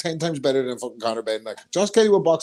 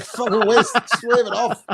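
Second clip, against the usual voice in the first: higher, dismissive call to wave off the fight; mock dismissive ref; 8 semitones higher, 3 dB louder than usual. Imitating a referee waving off a knockdown, higher and dismissive. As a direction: brush the fight off with a quick mocking wave. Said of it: "You're like".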